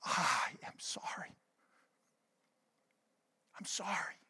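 hushed, whispered retelling of a tearful apology; barely audible, remorseful re-enactment; weeping apology. Retold or imitated. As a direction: whisper it brokenly, reliving the moment. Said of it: "I wept to them and I said".

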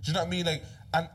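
normal talking voice

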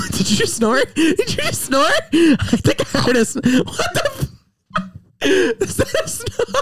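high, laughing disbelief. Real, not acted. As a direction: crack up while asking. Partner snorted mid-recording.

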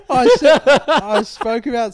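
high laughing voice. Real, not acted. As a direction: talk through loud laughter. Laughing while talking.

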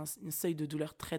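normal talking voice

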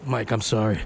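low, subdued pretend confession; lightly acted sheepish admission; mock apology. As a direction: imitate a caught employee apologizing, pitch dropped. Acting out caught employee's apology.

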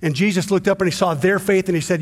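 ordinary delivery

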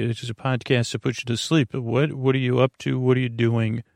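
ordinary talk

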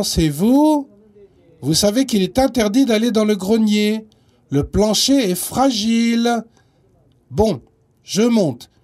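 loud, stern grandma calling upstairs. Acted. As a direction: call up the stairs, scolding. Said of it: "une voix retentit".

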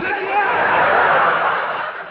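high yeah with a laugh; delighted fan. Retold or imitated. Conventioneer answering Kirk, high laughing yes. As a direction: answer stunned and thrilled. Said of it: "He said".

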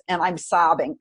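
normal talking voice

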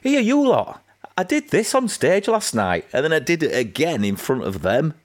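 high-pitched mock show-off to the office; mock boastful announcer. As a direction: announce it high, sending up your own cheek. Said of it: "someone coming to the office and going".

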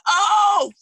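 high, loud retold "oh"; shocked reaction. Host retelling her reaction to a quoted price, shocked. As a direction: burst out as if startled by the price. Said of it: "I said".

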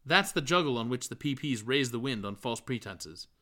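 ordinary delivery

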